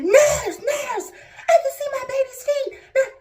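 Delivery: high, loud panicked call for the nurse; husband yelling for the nurse; shouted call. Retold or imitated. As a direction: yell it through laughing tears.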